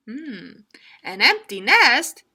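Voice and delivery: high chicken voice, questioning; a chicken tempted by the idea; curious chicken. Acted. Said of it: "cried the chicken".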